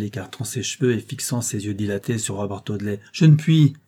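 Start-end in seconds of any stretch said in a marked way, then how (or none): 3.1-3.7 higher, louder, strained cry; anguished cry. A narrator's reading. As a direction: break into a higher desperate wail. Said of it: "s'écria-t-elle".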